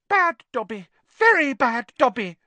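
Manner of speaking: high elf voice, self-scolding; squeaky, emphatic self-reproach; Dobby scolding himself. A narrator's reading. Elf berates himself after hitting his head, high-pitched.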